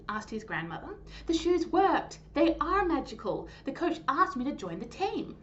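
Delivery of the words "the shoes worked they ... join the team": excited. Acted. Raheem shares good news excitedly.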